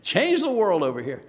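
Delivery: loud, high, hammed-up sarcasm; mocking taunt. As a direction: jeer with exaggerated mock awe. Acting out scoffers taunting the missionary.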